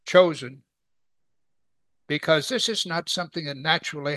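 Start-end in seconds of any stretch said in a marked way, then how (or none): 0.1-0.6 chosen said loud and set apart; stress on chosen. Shown as a demonstration. Teacher repeating the word she emphasizes, stressed chosen. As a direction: land firmly on chosen after a pause. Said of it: "I emphasize that word".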